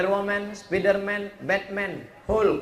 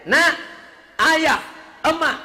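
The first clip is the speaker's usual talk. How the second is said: raised, high-pitched acted plea; impassioned plea; 6 semitones higher, 4 dB louder than usual. Child begging parents for religious schooling, impassioned. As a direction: plead loudly as the child to the parents.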